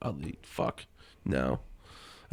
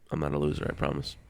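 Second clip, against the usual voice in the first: usual speaking voice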